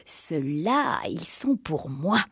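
low, slow evil witch laugh; wicked honeyed cackle. Acted. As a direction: gloat over the children in a deep sly voice. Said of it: "elle avait ri méchamment et dit d'une voix mielleuse".

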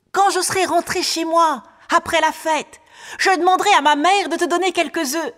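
high, loud shouted thanks; child calling out. Acted. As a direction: call far after someone leaving, high and loud. Boy calls after departing man. (enfant qui crie merci de loin, voix aiguë et forte)

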